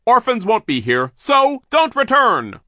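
high, loud, slow yell. Acted. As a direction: shout after them. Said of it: "Sir yelled".

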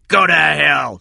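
loud defiant shout. Acted. Cy shouts back at his pursuer, loud defiance. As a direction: spit it back at him. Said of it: "Cy shouted".

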